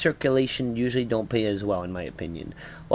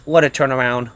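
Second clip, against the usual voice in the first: normal talking voice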